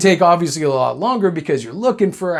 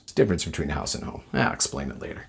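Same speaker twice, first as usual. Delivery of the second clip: fast low throwaway mutter; quick quiet aside; 10 semitones lower, 9 dB quieter, faster than usual. Real, not acted. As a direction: toss it off under your breath.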